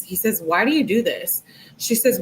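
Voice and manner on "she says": ordinary delivery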